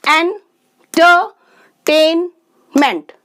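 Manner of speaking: Entertainment slow, with a gap after each syllable. Shown as a demonstration. Teacher counting four sounds, entertainment pulled apart by syllable. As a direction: break entertainment into four spaced beats. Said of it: "four sounds हैं".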